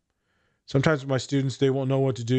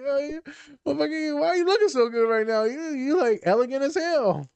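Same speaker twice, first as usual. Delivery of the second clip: sustained high falsetto admiration; mock gushing; 13 semitones higher than usual. Podcaster playing onlookers praising a student's walk, mock gushing. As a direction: gush in a put-on, very high voice.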